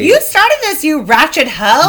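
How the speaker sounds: very high, sassy jab; mock her like a rival; mock insult. Speaker taunting the stranger, high and sassy.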